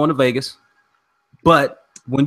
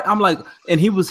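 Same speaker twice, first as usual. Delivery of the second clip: usual speaking voice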